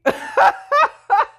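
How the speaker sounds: a very high, loud laugh through tears; teary laugh. Real, not acted. Host tearing up over her husband, teary laugh. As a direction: giggle at your own crying.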